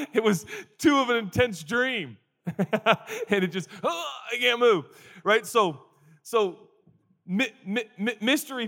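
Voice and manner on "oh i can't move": high, strained cry of pain; mock agony. Preacher acting out waking in pain, mock agony. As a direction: groan it out high, as if seized up.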